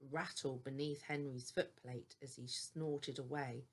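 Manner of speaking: ordinary delivery, nothing marked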